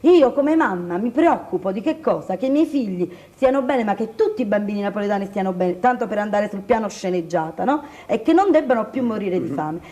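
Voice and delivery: ordinary talk, nothing marked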